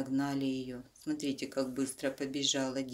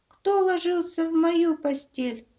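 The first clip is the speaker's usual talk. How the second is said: much higher, louder thin squeak; squeaky bear cub; 9 dB louder than usual. Acted. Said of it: "запищал тонким голосом".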